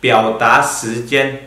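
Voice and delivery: each syllable laid out slowly; slow careful Mandarin. Shown as a demonstration. Teacher introduces topic name to class, slow and clear. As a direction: read the Chinese term slowly for learners. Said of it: "Kelima yaitu".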